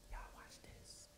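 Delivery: hushed whisper to the class. Retold or imitated. Kid whispering to classmates before a prank, hushed. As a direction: whisper it like a secret dare.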